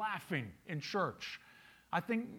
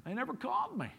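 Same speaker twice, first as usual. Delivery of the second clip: higher, mock-sulky whine of a hurt churchgoer; sulky complaint; 8 semitones higher than usual. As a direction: whine it like someone waiting to be called. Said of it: "their primary reason is".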